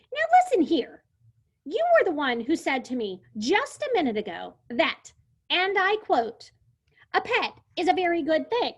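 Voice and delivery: high, loud, snippy scolding. Acted. Lulu quotes the dinosaur back at him in a snippy voice. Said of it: "in a very snippy voice".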